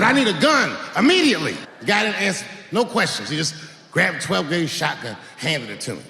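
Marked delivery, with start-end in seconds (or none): 0.0-1.6 urgent demand. Retold or imitated. Urgent demand in the gun shop.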